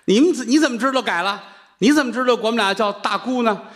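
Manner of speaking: high, delighted questions; pleased nuns. Acted. Nuns delighted at his apology, asking high and pleased.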